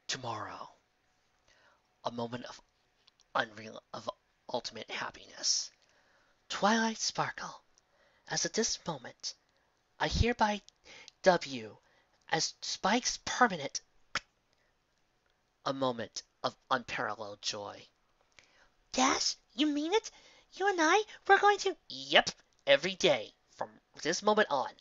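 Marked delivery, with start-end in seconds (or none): 0.1-5.7 low, soft hushed trailer voice; trailer narration. Acted. 15.6-17.8 low, soft hushed trailer voice; trailer narration. Acted. 18.9-21.8 put-on much higher, eager child voice; excited young filly.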